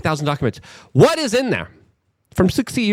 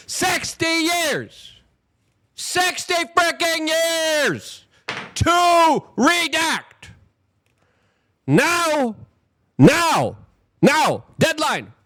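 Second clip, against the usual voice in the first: outraged shout; 9 semitones higher, much slower than usual. Real, not acted. Outraged rant at delay.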